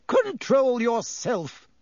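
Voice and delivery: high, very slow shrieked command; shrieking order. A narrator's reading. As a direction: scold him firmly. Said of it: "shrieked Madame Pomfrey".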